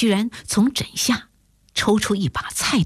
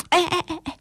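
eager, higher-pitched interjections; quick repeated calls, pitched up; impatient; 6 semitones higher than usual. A narrator's reading. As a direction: stop a friend from dropping off, urgently. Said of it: "迫不及待地说".

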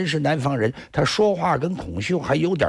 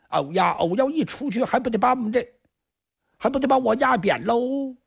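high, whining fretful voice; a trapped thief panicking under his breath, pitched high; high fretful whine of a cornered man; panicked hiding thief; 7 semitones higher than usual. Acted. Kong Xiu panicking inside the sack.

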